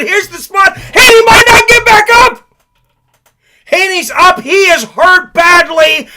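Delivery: screaming excited play-by-play; wrestling commentator. Real, not acted. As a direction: yell the knockdown call at full pitch. Knockdown called live, shouting.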